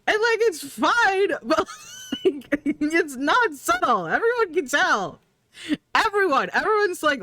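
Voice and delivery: excited high rant. Real, not acted. Excited rant about Lex.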